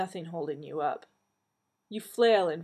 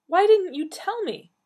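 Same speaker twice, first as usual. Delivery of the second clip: raised, high-pitched indignant demand; 13 semitones higher, 9 dB louder than usual. A narrator's reading. Donna confronting her family, high and loud indignant demand. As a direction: confront them loudly, pitch way up.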